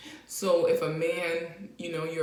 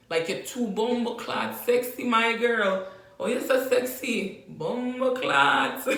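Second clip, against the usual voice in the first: higher, louder Jamaican flirt; Jamaican man flirting; 4 semitones higher, 4 dB louder, slower than usual. Retold or imitated. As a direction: Jamaican patois catcall, slow bomba clots. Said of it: "Jamaican man is saying".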